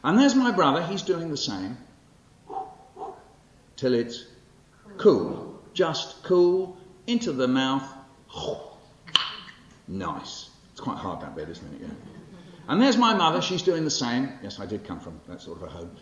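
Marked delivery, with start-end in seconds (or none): 0.1-10.5 bright, bouncy storytelling read, higher and louder; lively poem performance. Acted. Poet performing his childhood poem to the audience, lively and animated. 12.7-14.3 bright, bouncy storytelling read, higher and louder; lively poem performance. Acted. Poet performing his childhood poem to the audience, lively and animated.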